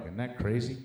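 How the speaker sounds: ordinary talk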